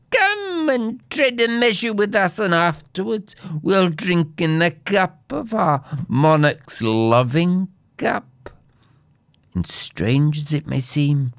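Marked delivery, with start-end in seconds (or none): 0.1-8.5 high-pitched goblin invitation, easing down; goblins calling out. A narrator's reading. Goblins invite the earl into their ring, calling out. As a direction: start high, luring him to join. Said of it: "they shouted".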